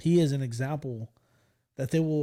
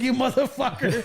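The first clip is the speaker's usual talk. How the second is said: higher, laughing retold curse; mock-angry outburst; 9 semitones higher, 4 dB louder than usual. Former student still mad at teacher, mock angry. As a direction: curse him out, half laughing. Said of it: "I was still mad".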